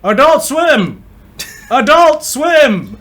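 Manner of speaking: loud, high, drawn-out announcer yell; stretched-out poolside holler, imitated; megaphone yelling. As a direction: yell it slowly like through a megaphone. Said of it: "someone just on a megaphone yelling".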